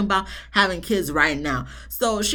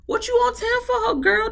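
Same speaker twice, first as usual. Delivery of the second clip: much higher, exasperated scolding; incredulous high scold. Retold or imitated. Recapper scolding Suki in high disbelief. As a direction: squeal the question at her.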